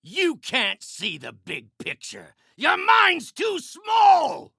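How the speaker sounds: enraged villain insult; furious belittling. Acted. Villain berates foe in fight.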